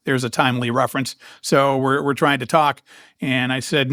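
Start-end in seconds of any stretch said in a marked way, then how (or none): none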